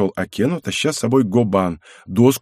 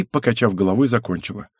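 usual speaking voice

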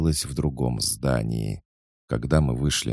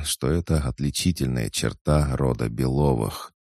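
ordinary delivery, nothing marked

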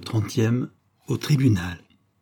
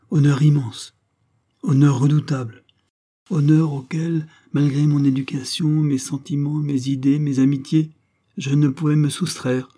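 grave proclamation; 6 semitones higher, 5 dB louder than usual. A narrator's reading. Grave declaration of fate.

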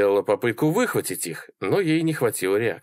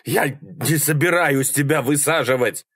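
higher-pitched exasperated shout; moderately raised shouting voice; gasping yell; 5 semitones higher than usual. A narrator's reading. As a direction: yell it while gulping air. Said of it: "орал Малькольм, глотая воздух".

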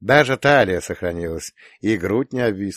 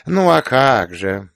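feigned amazement; 4 semitones higher, 6 dB louder, slower than usual. A narrator's reading. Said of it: "изобразила изумление".

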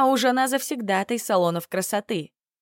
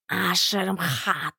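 drawn-out s like a snake; 2 semitones lower, much slower than usual. A narrator's reading. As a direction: whisper it silkily like a serpent. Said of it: "прошептал он бархатным, словно змеиным голосом".

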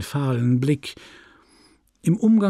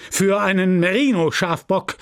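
raised, higher announcer voice; chairman calling out; 5 semitones higher, 3 dB louder than usual. Acted. Chairman announces a prize for a ram, calling out.

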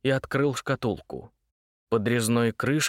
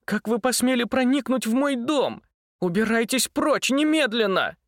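much higher, louder indignant order; furious demand; 11 semitones higher, 3 dB louder than usual. Acted. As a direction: order him out, outraged. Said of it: "требовала Варя". (гневное требование)

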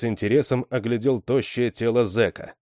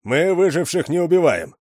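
raised, commanding warning at gunpoint; stern warning; 8 semitones higher, 6 dB louder than usual. A narrator's reading. Stranger at gunpoint warns survivors, loud and stern. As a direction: bark the warning down the road.

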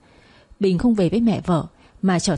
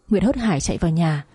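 ordinary delivery, nothing marked

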